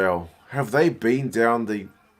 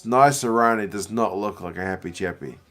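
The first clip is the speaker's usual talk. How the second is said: normal talking voice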